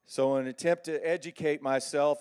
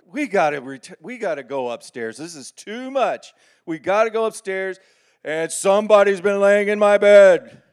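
loud urgent papa bear; 5 semitones higher, 14 dB louder than usual. Acted. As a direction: bear father rallying the family upstairs. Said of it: "So I go".

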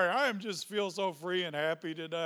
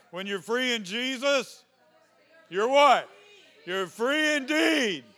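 higher, louder, slow on indeed; preaching shout; 7 semitones higher, 9 dB louder, slower than usual. Real, not acted. Pastor shouting freedom to the congregation, exultant. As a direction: proclaim it like a victory. Call and response peak.

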